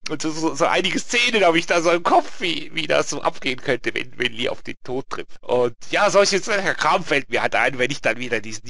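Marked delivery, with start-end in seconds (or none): none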